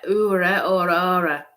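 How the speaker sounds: "oora" drawn out slowly, then "aura"; sounding out a word. Shown as a demonstration. Medium guessing at a foreign greeting, sounding it out. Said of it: "it sounds like".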